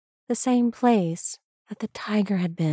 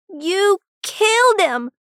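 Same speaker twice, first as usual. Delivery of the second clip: high, loud, drawn-out accusation; furious yell; 13 semitones higher, 6 dB louder, slower than usual. A narrator's reading. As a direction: yell it at your father, stretching each word. Son confronts father after shooting.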